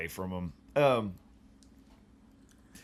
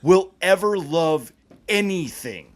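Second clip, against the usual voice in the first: loud, high, emphatic rant; impassioned proclamation; 8 semitones higher, 13 dB louder than usual. Pretend. Host declaring to anybody who wants to know, impassioned. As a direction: proclaim it at full voice, pitched way up. Mock tirade about the episode.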